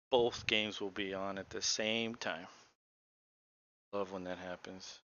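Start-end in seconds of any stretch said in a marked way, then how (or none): 3.9-5.0 dry, sarcastic deadpan. Real, not acted. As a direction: say it with heavy sarcasm.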